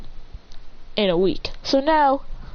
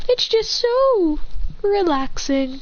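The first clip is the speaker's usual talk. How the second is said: gloating purr; 11 semitones higher, much slower than usual. Acted. Gloating villain.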